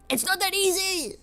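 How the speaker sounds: high-pitched, upset retort; exasperated protest. Retold or imitated. Artist answering people who say remake it, exasperated. Protests at being told to redo art.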